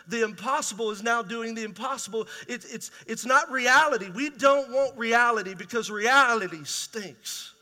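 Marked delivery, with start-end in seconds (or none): 2.5-7.5 louder, slower preached line; forceful preaching. Acted. Pastor warning the church about hard reality, louder and slower. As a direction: lean into it for the room.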